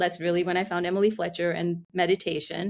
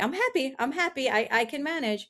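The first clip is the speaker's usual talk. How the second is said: higher, brittle insistence that all is fine; forced cheer; 5 semitones higher than usual. Retold or imitated. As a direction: talk yourself into being happy, pitched up. Forced self-talk through depression.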